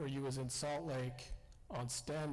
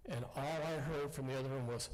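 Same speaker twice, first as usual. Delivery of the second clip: normal talking voice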